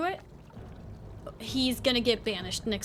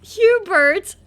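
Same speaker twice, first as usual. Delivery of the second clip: calling out; 9 semitones higher, 14 dB louder than usual. Real, not acted. Calling out to a friend.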